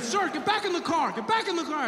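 high-pitched, frantic cop order; freaked-out policeman. Retold or imitated. Officer orders man back into car, freaked out. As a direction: imitate a panicky officer giving orders, voice way up. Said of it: "just as freaked out as I was".

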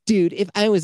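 high, loud catty mocking voice. Shown as a demonstration. Mocking a friend's text in a catty high voice. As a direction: sneer it out like a catty gossip. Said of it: "I just give him this voice".

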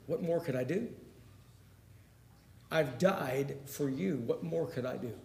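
soft, slowing, inward question; quiet plea. Acted. Jesus to those who reject him, quiet plea. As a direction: plead quietly, leaving space between phrases. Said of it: "picture Jesus saying".

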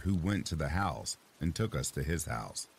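plain reading voice

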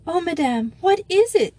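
put-on high, distressed girl's plea; tearful character voice, pitched far up; acted sobbing. A narrator's reading. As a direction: plead as if near tears, rising loud and high. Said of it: "sobbed Bet in German".

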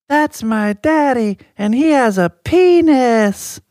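put-on high, loud, drawn-out child's yell; little girl yelling. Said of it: "Just yells it".

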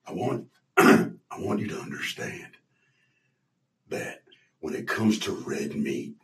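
Gary Busey impression. Busey pitching Omaha Steaks to the owner in a low hush. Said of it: "God, I haven't done this impression".

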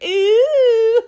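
high, loud teasing ooh; mock ooh. Seeing his shoulders emerge from the dark, teasing ooh. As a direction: coo at the bare shoulders.

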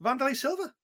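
lightly voiced honourable fighter; respectful fighter. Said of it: "And Brian Stann went".

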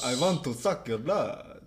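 put-on slow vampire in a Transylvanian accent. Player hinting at Dracula, slow Transylvanian accent.

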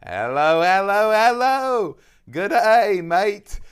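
loud mock Cockney-Australian greeting, pitched high; over-the-top fake Cockney accent, rising high; bad Cockney accent. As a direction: ham up a muddled British-Aussie accent. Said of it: "that was kind of a, um, Cockney".

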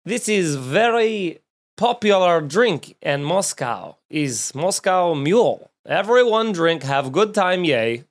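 hammed-up Russian accent, slow; a mock Russian seller pitching the drink, slowly; bad Russian accent. Host mimicking a Russian immigrant selling copper mugs, bad Russian accent. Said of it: "a bad Russian accent".

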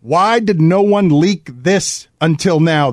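ordinary delivery

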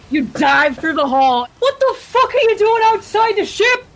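high, loud incredulous yell; full-volume outraged shipmate; shouted disbelief. Acted. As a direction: yell at a reckless crewmate in astonishment. Jory yells at diving crewmate.

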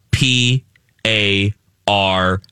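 slowly spelled letter by letter. Shown as a demonstration. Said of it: "You know what I mean?".

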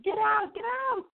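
high-pitched, panicked re-enacted shout; acted shriek at intruders; freaked-out yell. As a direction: yell them out of the room in a panic. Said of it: "I'm freaking out".

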